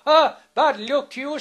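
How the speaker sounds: loud, scornful rebuke; scolding. Retold or imitated. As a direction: tell him off sharply. Peg scolding fairy in story.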